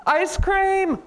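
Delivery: high, loud burst of delight; thrilled story character spotting a treat; excited elephant. Acted. Elephant seeing the penguin's ice cream cart, excited. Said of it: "And elephant says".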